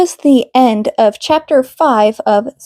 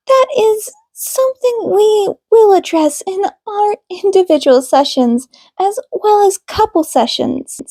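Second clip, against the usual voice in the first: higher, measured counselor voice; calm therapist; 7 semitones higher than usual. A narrator's reading. Counselor Minami addressing the couple in session, composed and higher. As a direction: speak like a gentle, professional marriage counselor.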